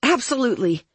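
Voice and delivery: high, too-assured call; covering up. A narrator's reading. Dory reassures Claire upstairs, high call.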